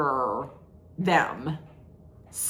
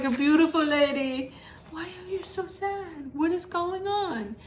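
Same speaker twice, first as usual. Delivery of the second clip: higher, hammed-up charming Italian man; mock suave Italian; 8 semitones higher, 4 dB quieter than usual. Voicing Giovanni comforting her.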